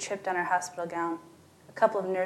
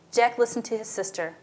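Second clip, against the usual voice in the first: ordinary delivery, nothing marked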